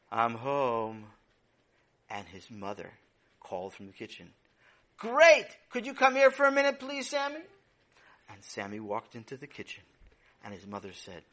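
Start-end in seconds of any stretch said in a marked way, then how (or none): 0.1-1.1 lower, worn-out sigh of a greeting; tired voice. Acted. Sammy coming home exhausted after his busy day, tired voice. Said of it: "he said in a tired voice". 5.0-7.4 much higher and louder bright call. Acted. Mother calling Sammy into the kitchen, loud cheerful call.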